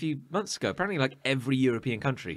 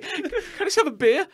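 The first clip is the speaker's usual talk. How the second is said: laughing high plea. Retold or imitated. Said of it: "Or you'll go like".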